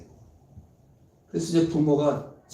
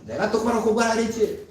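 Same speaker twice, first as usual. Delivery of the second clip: higher, nagging parental rebuke; mock scolding; 8 semitones higher, 3 dB louder than usual. Parent scolding a child over bad grades, mock rebuke. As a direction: nag the child like an annoyed parent. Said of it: "얼마나 야단을 치겠어요".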